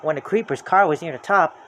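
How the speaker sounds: plain reading voice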